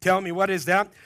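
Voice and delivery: normal talking voice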